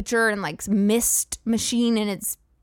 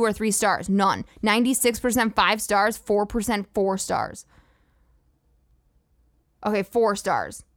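ordinary talk

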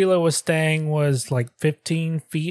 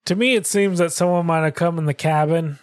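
ordinary talk

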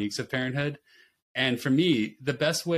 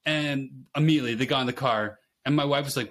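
normal talking voice

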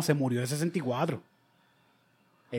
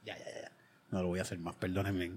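hushed apology; 10 semitones lower, 15 dB quieter than usual. Real, not acted. Apologising after the joke.

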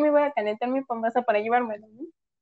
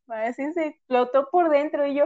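usual speaking voice

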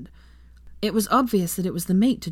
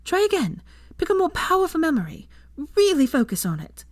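higher, bright encouraging woman; Hermione's voice; 11 semitones higher than usual. A narrator's reading. Narrator voicing Hermione encouraging Draco. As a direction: voice Hermione cheering him on.

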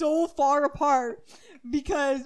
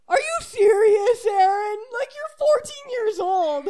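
higher, louder exasperated dad; disbelieving dad; 9 semitones higher, 8 dB louder than usual. Retold or imitated. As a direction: scold her in amused disbelief.

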